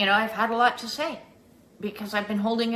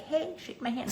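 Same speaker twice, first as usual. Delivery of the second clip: much higher, mock friendly bait; prankster offer; 9 semitones higher, 4 dB quieter than usual. Eighties friend baiting a handshake, mock friendly. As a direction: set up the prank sweetly. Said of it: "people would be like".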